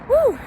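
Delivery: loud high cheer whoop; excited whoop. Real, not acted. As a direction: whoop for the goal. Cheering a hockey goal mid-run.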